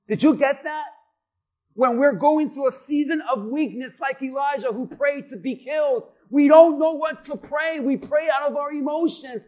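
preacher rising to fervour; preaching. Acted. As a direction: drive the point home to the congregation. Preaching builds in intensity.

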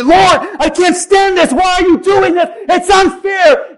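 a doubter shouting at heaven; critical protest to God. Retold or imitated. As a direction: cry out at the unfairness. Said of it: "get critical and say".

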